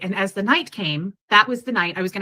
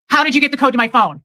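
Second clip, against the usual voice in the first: raised, higher angry retold voice; angry demand; 4 semitones higher, 6 dB louder, faster than usual. Woman retelling his outburst over the phone code, angry demand. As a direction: imitate an angry man's loud demand. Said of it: "And he went".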